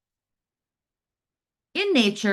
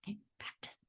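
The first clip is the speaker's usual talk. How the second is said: whisper; 21 dB quieter, faster than usual. Real, not acted. Prompts class to practise.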